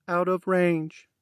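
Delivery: high, slow machine voice; robotic female voice. A narrator's reading. Helmet AI answers Max, slow robotic voice. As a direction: read it like an AI assistant. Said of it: "the robotic feminine voice said".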